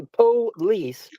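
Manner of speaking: "police" stretched into two beats, PO... LEECE; painstakingly sounded-out "police"; slow, split syllables. Retold or imitated. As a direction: say "police" slowly with a clear gap between syllables. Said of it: "so I have to say".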